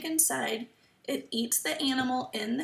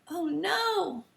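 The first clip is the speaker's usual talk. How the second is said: put-on kids show host gasp; 6 semitones higher than usual. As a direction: gasp then mock alarmed oh no. Teacher reacts to book.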